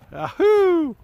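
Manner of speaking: high happy whoop; excited cheer. Real, not acted. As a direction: cheer as he slides. Cheering kid sledding down.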